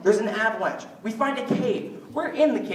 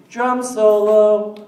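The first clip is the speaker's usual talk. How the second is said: loud, drawn-out mock announcement; bored drum-solo outburst; 8 dB louder, much slower than usual. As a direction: belt it out, stretching each word. Bored, sitting there in a classroom.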